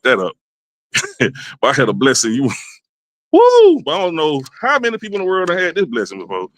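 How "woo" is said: excited whoop. Real, not acted. Whoops over a blessing.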